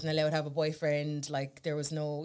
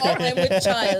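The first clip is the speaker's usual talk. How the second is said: mock Virgin Mary; 7 dB louder, slower than usual. Mock pregnancy joke.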